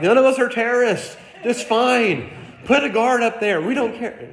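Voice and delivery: high, loud pleading to the airline; begging them to just fly, voice up high; exasperated plea. Retold or imitated. To the airline about the cockpit door, high exasperated plea. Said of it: "we're like".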